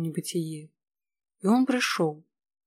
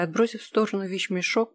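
plain reading voice

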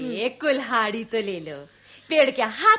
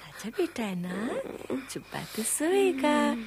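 softer, slow, tender coaxing; soothing lull; 8 dB quieter than usual. Acted. Mother softly soothing her frightened son. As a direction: ease him back to sleep.

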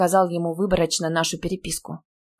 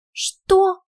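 high, loud shocked question; startled shriek of a question; shocked gasp; 13 semitones higher, 6 dB louder than usual. A narrator's reading. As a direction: gasp it out in disbelief. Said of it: "ахнула я".

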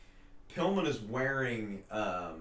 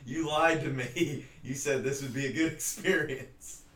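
laughing mock accusation; 5 semitones higher, 3 dB louder than usual.

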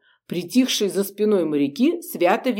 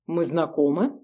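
cool, dismissive question; indifferent; 3 semitones lower, 3 dB quieter than usual. Acted. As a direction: ask it with bored disdain. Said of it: "безразлично поинтересовалась".

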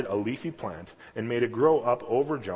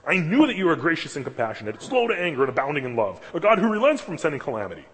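louder, higher indignant charge; accusing; 6 semitones higher, 6 dB louder than usual. Retold or imitated. Jonah accusing God of mercy, indignant. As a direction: list the charges against God. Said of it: "accusing God of these awesome things".

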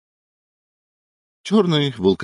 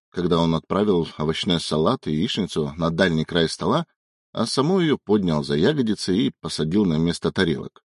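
plain reading voice

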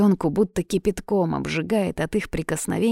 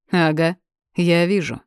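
ironic; 4 dB louder than usual. A narrator's reading. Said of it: "иронизирует Борька".

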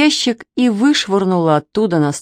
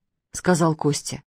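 usual speaking voice

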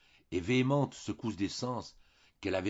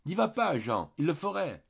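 much higher, louder frightened plea; urgent plea; 10 semitones higher, 4 dB louder than usual. A narrator's reading. Narrator holding Jean back, pleading in alarm. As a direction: beg him to stop. Narrator grabs Jean's arm, warns.

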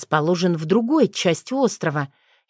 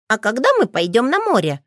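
put-on high, eager little-boy question; perked-up child; 5 semitones higher than usual. A narrator's reading. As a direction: ask like an excited kid, swooping up. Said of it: "встрепенулся Лео". (оживлённым детским голосом)

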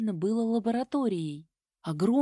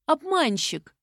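loud, higher indignant cry; sulky outburst; 6 semitones higher, 4 dB louder, slower than usual. A narrator's reading. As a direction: pout and call him a liar. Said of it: "насупилась я". (надувшись, возмущённо)

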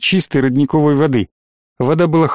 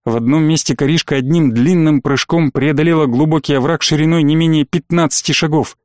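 ordinary delivery

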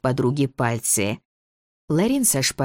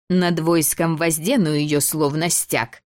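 торжественная декламация; 4 semitones higher, slower than usual. Acted. Декламирует боевой клич пьесы.

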